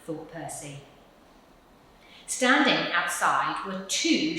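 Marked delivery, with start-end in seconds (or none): none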